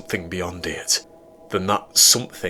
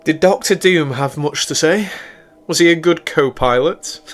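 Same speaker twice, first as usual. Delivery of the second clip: high, louder teasing female voice; playful woman; 9 semitones higher, 5 dB louder than usual. A narrator's reading. Celine greeting the captain at his bunk, high and teasing. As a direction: tease him up high, like his partner.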